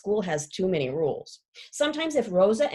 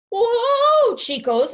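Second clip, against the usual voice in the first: much higher, louder alarmed "Whoa"; startled cry; 13 semitones higher, 9 dB louder, much slower than usual. Acted. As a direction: yelp it to stop them. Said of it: "I tell them".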